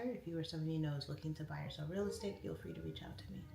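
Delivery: quick promo pitch, trailing off; ad read. Acted. Realtor plugging her services to stream viewers, quick promo read. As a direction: rattle off the realtor plug like a commercial.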